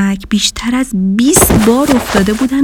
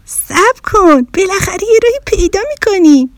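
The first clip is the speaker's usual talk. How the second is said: eager friend bear; 10 semitones higher than usual. Acted. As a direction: call her back, then rush out the hope. Friend bear urges her to stay.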